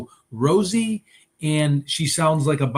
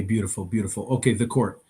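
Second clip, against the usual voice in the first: ordinary delivery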